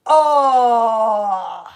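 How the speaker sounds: loud, drawn-out "ohhh"; big theatrical sustained exclamation; long "oh". Acted. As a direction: hold one loud falling "oh". Long exclamation before accent attempt.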